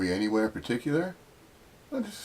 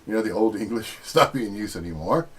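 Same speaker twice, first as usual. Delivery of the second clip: ordinary delivery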